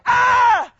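a sudden loud cry of pain; pained yell. Retold or imitated. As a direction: yell out as if shot. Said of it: "Greg goes".

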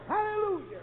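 high, ringing hallelujah; exultant praise. Acted. Healed man praising God as he claps, exultant high cry. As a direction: let the praise leap up high, then fall.

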